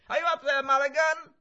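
very high, loud shout from below; full-voiced distant call; calling loudly. A narrator's reading. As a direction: holler up the tower to someone. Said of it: "A voice within the tower called loudly".

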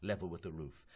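ordinary delivery

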